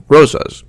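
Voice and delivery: rosas ending in a buzzed zuhs; Americanized pronunciation. Shown as a demonstration. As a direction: say rosas as ROH-zuhz. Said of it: "sounds like Z U H S".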